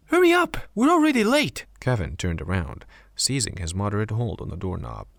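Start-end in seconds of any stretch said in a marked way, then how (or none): 0.1-1.7 very high, loud impatient shout. A narrator's reading. Zara calling after Kevin at the bathroom door, high loud shout. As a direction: yell it across the room so he hurries. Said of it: "Zara shouted from behind".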